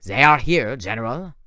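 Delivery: a lightly voiced French accent, stiff and formal; slight French accent, fawning. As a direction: Thorne bowing to the general in a French accent. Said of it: "spoke his weird French accent".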